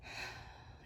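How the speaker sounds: soft breathy sigh. Acted. Eliza sighing over the sad creature, a soft unvoiced sigh. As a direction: let out a gentle sigh. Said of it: "Eliza sighed".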